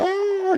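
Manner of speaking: a sudden very high shriek of alarm; high startled cry; mock shocked scream. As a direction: shriek like a scandalized bystander. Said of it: "para salir gritando".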